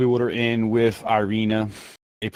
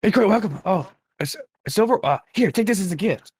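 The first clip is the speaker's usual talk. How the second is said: high, thrilled shopkeeper voice; excited toymaker; 8 semitones higher than usual. Acted. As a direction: gush with delight over the coin. Toymaker delighted by silver.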